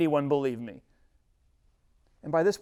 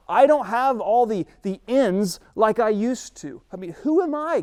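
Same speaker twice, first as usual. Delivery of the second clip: Moses protesting; 6 semitones higher, 7 dB louder than usual. Acted. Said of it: "He first says".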